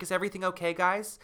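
high-pitched, lightly acted counselor; chipper counselor check-in. Counselor pops into the cabin, high-pitched concern.

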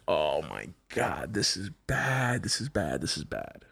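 low, soft, slow panicked mutter, dropping to almost nothing; sinking dread, saying it to himself; quiet panic fading away; panicked inner voice. Retold or imitated. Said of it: "I'm like".

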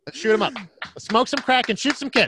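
high, loud, laughing rally cry; mock gung-ho cheering. Donor jokingly egging the band on, loud mock enthusiasm. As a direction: whoop it up as an absurd joke, pitched high.

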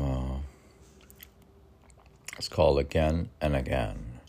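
ordinary talk, nothing marked

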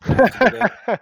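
jokey squeaky question, loud; high-pitched squeak. Real, not acted. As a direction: squeak it out in mock protest. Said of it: "should I do an Austrian voice?".

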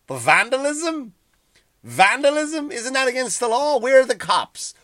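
indignant rising outburst; outraged. Real, not acted. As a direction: demand answers, appalled. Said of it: "outraged rhetorical questions".

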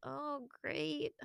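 soft, flat sarcasm; sarcastic. Retold or imitated. Reacting to the bad gift box, softly sarcastic. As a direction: say it with a sour letdown. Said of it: "So I was like".

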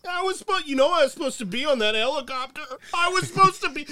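put-on shrill childish whine at the press conference; whiny high Trump-ish voice. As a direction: play him as a wailing kid wanting credit.

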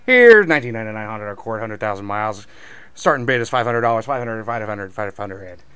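loud fast cattle auction chant; auctioneer. Pretend. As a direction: rattle off bids like an auctioneer. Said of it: "I wanna see your best Texas cattle auctioneer voice".

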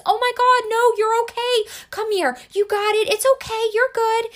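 put-on high, loud, rushed "you're okay" to a hurt child; frantic reassuring parent. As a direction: gush over the child to stop the tears. Parent rushing to crying child.

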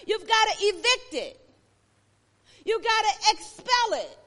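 loud, high, driving sermon cry; preacher pushing the congregation hard, loud and high; preaching at full force. Acted.

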